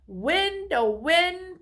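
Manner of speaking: high ringing call to the wind. A narrator's reading. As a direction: summon the wind with longing. Calling to the wind.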